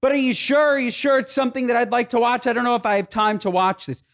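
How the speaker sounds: whiny wife. Retold or imitated. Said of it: "she'll turn back and she'll say".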